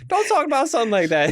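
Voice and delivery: high scolding granny voice; imitating the grandmother. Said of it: "the grandmother is like".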